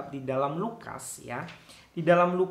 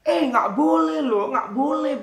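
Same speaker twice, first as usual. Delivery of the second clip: angry papa; 9 semitones higher, 7 dB louder than usual. Retold or imitated. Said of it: "papa marah lagi".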